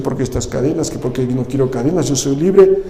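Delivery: a hint of a sulky prisoner; mock indignant complaint. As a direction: grumble like a prisoner refusing his chains. Imagined complaining Paul.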